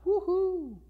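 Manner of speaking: high jeering woo-hoo; mock jeering whoop. Priests gloating over Jesus, high jeering whoop.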